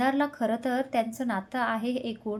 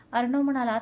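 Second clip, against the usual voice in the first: ordinary delivery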